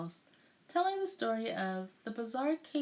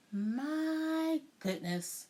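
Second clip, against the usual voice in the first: put-on slow, high, drawn-out sweet old lady; old woman tutting; 7 semitones higher, much slower than usual. A narrator's reading. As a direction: shake your head as you say it. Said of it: "with a shake of her head".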